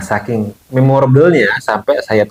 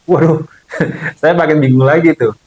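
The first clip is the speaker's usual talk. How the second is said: higher, laughing exclamation of dismay; bewildered laughing; 5 semitones higher than usual. Real, not acted. Storyteller recalls being given only one week, bewildered laughing. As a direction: laugh helplessly through the words.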